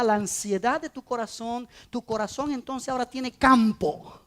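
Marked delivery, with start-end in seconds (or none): none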